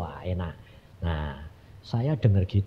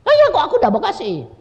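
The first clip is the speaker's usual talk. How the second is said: put-on high, loud childish complaint; whining protest; 13 semitones higher, 5 dB louder than usual. Asking God why no house is given, whining protest. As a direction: protest like a child treated unfairly. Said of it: "pokoknya ngerengek terus".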